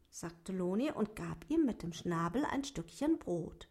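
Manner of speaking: plain reading voice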